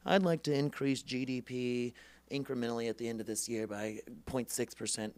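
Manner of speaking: droning, even mock candidate speech; dull politician. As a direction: imitate a boring stump speaker. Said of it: "they're gonna be like".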